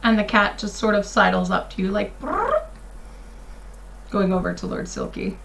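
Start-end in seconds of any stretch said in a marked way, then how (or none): 2.2-2.6 high trilling cat purr. Retold or imitated. Cat's purring noise.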